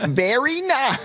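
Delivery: put-on Very nice high and slow in a goofy weird accent. Co-host echoing the bit in a weird accent. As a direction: copy the friend's weird accent, stretched out. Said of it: "in a weird accent".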